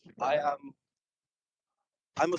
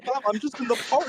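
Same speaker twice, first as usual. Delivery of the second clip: high voice; 8 semitones higher than usual. Pretend. High squeaky mom voice.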